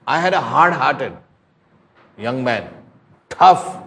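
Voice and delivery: loud, high impassioned preaching; preaching at full force. Acted. Preacher urging the congregation to come, at full force. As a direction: plead with the crowd, voice ringing out.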